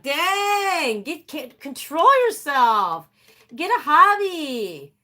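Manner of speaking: high, drawn-out mock scolding; exasperated scolding. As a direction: stretch it out in exasperation at the dog. Mock scolding of the humping dog.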